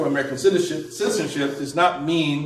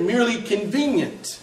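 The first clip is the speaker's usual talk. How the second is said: "merely convenient" set apart with raised, deliberate emphasis; stress on convenient; 6 semitones higher, 4 dB quieter than usual. Real, not acted. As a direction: lift the pitch on "convenient" to underline it. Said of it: "I emphasize the word convenient".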